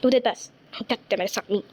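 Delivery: ordinary talk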